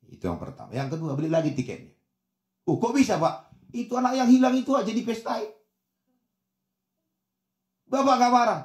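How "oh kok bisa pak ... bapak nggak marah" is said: high-pitched, incredulous questioning; amazed child. Acted. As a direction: voice a disbelieving child asking Dad. Child amazed father isn't angry. (anak terheran-heran, nada tinggi)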